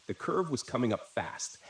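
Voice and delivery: ordinary delivery, nothing marked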